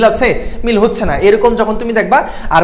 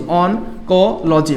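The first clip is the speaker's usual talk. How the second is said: "Oncology" very slow, broken into separate syllables; 2 semitones lower, 4 dB quieter, much slower than usual. Shown as a demonstration. Teacher repeating a new word for the class, "oncology" slowly syllable by syllable. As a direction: spell out "oncology" slowly by sound, pausing between parts. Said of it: "oncology কি বলা হয়?".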